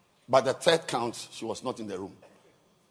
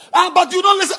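angry shout; 18 dB louder, faster than usual. Retold or imitated. Wife shouting back, retold.

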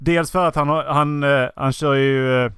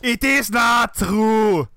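higher, slow mock denial; Arnold Schwarzenegger; 4 semitones higher, much slower than usual. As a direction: stretch it out in a put-on action-star accent. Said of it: "hade varit Arnold Schwarzenegger som var Tommy Wiseau".

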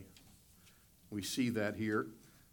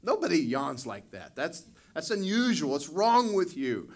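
higher, louder mock wife complaining; exasperated wife; 5 semitones higher, 6 dB louder than usual. Wife scolding husband about his yawn, mock exasperated. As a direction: play the wife, baffled and louder. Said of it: "my wife's like".

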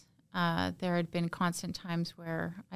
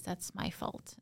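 whispered self-blame; 11 dB quieter than usual. Real, not acted. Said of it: "reverting back to how could I".

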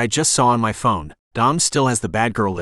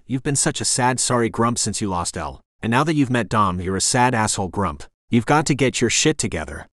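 ordinary delivery, nothing marked

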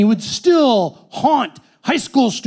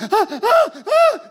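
panting gasps; 3 dB louder, slower than usual. Retold or imitated. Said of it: "And I'm like".

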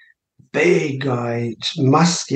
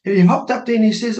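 ordinary talk

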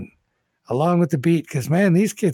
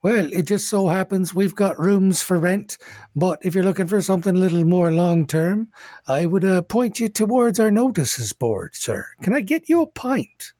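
raised, polite tavern keeper; a higher, obsequious barkeep serving guests; bartender voice; 6 semitones higher than usual. Acted.

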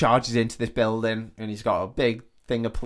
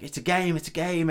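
higher-pitched mock panic; retold panic; 6 semitones higher than usual. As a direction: act out frantic self-reassurance, pitched up.

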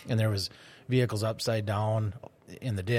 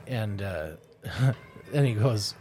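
normal talking voice

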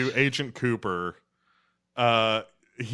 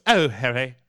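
governess voice; 5 dB louder than usual. Retold or imitated. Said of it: "she sounds like a governess".

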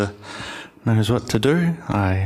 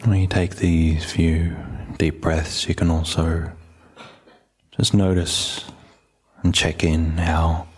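low, even meditation voice with long pauses; calm, unhurried settling-in voice; guided meditation; 4 semitones lower, slower than usual. Real, not acted. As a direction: gently guide listeners inward, leaving space between phrases.

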